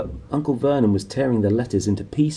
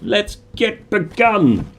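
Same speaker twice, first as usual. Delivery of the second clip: slow, high, playful; 10 semitones higher, 5 dB louder, much slower than usual. Pretend. Playful mock announcement.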